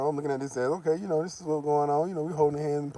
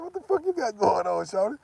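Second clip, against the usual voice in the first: higher, mock confrontational; suspicious; 8 semitones higher than usual. Confronting his girlfriend over her scheme, higher suspicious. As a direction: call her out, half joking. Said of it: "I said".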